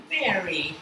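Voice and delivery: a soft, low, loving "Mary," said gently; a lightly voiced tender call of "Mary,". Shown as a demonstration. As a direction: say "Mary," softly to console her. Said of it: "I can almost imagine the love in his".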